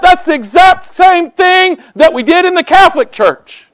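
loud, high, emphatic preacher shout; shouted sermon point. Acted. Preacher calling out the congregation's habits, shouting high. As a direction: hammer the point home to the back pew.

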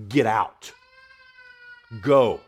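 loud rebuke to Legion; commanding rebuke. Retold or imitated. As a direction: cast out a demon with authority. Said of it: "the tone of voice ... to Legion".

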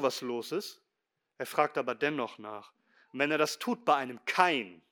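ordinary talk, nothing marked

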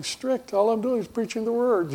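high, startled disbelief; incredulous. Retold or imitated. Pastor answers a member who calls him too strict, high and incredulous. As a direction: balk at the word Strict. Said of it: "I'm going".

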